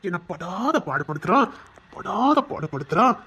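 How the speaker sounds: slow, drawn-out pretend complaint; mock complaint. Suitor coaching her complaint to her family, drawn-out mock grievance. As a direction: stretch out the mock grievance, word by word. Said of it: "அப்படின்னு சொல்லு".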